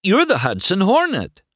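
high, loud awestruck exclamation; thrilled astonishment; amazed. A narrator's reading. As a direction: blurt out the discovery, pitch way up. McQueen amazed at his discovery.

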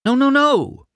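high, loud, rapid-fire protest; emphatic, desperate denial; insistent. Acted. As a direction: insist urgently over the laughter. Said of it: "McQueen insisted".

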